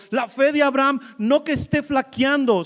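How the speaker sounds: ordinary delivery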